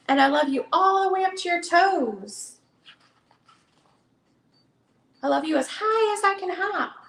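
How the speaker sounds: gentle storybook read-aloud; reading to children. Acted. Seller reads the hare book to viewers, storytelling voice. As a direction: read it like bedtime for a little one.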